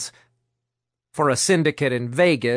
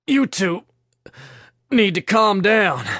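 strained, higher-pitched plea, broken by a pause; breath broken by effort; 6 semitones higher, 3 dB louder than usual. A narrator's reading. Thomas holding two struggling captives off the ground, breath broken by effort. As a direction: force the words out under a heavy load. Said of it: "his breath broken by effort".

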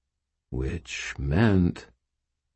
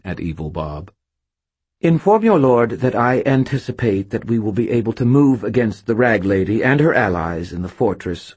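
high, loud imperious spirit voice; haughty spirit queen; 5 semitones higher, 4 dB louder than usual. A narrator's reading. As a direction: regal dismissal, grandly. Voices Lady Shade.

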